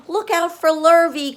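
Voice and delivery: very high, loud warning call; cows calling out. A narrator's reading. The cows warning Wilbur about Lurvy, loud call. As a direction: shout a warning. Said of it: "called the cows".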